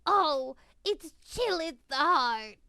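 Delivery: loud, theatrical dread; hammy horror. Acted. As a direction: melodramatic cry of fear.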